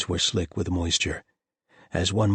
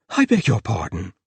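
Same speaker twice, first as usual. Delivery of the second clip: startled, higher and louder; gasped; 4 semitones higher, 7 dB louder than usual. A narrator's reading. The host startled by a talking cat, gasping. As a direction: caught off guard. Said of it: "he gasped".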